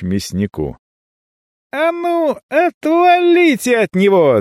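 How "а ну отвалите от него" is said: shrieking. A narrator's reading. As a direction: shriek at them to back off. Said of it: "заверещал я".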